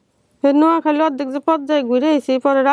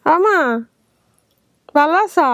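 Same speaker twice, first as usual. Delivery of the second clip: raised voice for a deaf listener; to someone hard of hearing; 4 semitones higher than usual. Real, not acted. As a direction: speak up so she hears. Calling to deaf mother on call.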